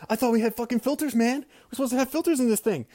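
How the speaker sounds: acted-out frantic blame-shifting, easing at the end; amped, freaked out. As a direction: rant defensively as if the job is on the line. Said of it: "I'm getting all amped".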